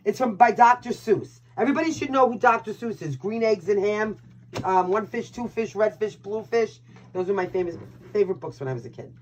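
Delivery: ordinary delivery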